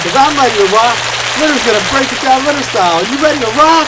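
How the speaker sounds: hyping the crowd. Acted. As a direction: work the crowd up.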